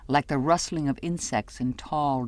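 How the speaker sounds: ordinary delivery, nothing marked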